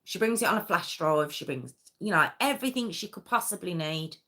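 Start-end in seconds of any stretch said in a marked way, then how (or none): none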